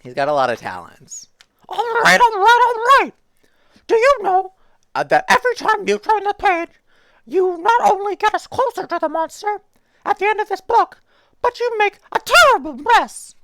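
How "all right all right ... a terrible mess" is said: very high, much louder, fed-up puppet; high Muppet scolding; exasperated Grover. Acted. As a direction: lecture the reader in a high squeak. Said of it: "tried to do the Grover voice".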